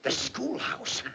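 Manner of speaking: slow, breathy whisper of an idea; hushed musing. Acted. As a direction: whisper it as a dream dawns. Boss dreaming of school contract.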